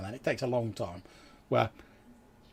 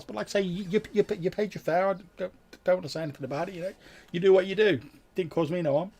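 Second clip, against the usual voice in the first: lightly voiced ferryman, pitched up; easygoing ferryman; 8 semitones higher than usual. Ferryman refusing to judge a paying passenger, lightly voiced and easygoing. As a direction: wave it off in a higher character voice.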